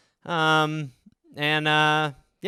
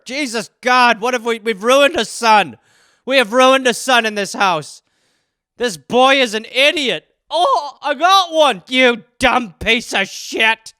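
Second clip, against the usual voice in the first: loud, higher, hammed-up rant; appalled angry parent; 5 semitones higher, 7 dB louder than usual. Parents despair over ruined son, furious. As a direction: rail in despair.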